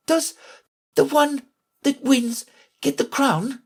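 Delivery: put-on high girl's voice, broken by gasping pauses; halting, winded question; out of breath. A narrator's reading. Alice questioning the king while running, breathless. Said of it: "putting her quite out of breath".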